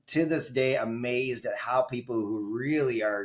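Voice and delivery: ordinary delivery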